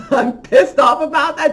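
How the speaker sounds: loud, high, laughing outrage; laughing angrily. Real, not acted. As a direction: laugh it out in real annoyance. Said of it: "I'm beyond pissed off".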